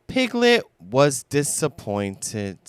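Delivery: plain reading voice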